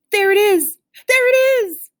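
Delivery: high, loud frantic squeal; panicked squeal. A narrator's reading. Scared squirrel panics.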